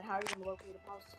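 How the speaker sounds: normal talking voice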